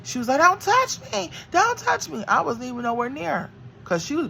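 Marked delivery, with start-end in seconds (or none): 0.4-2.2 very high, loud pleading mimic; mock scared doctor. Mother mimics the doctor backing away in a high squeal.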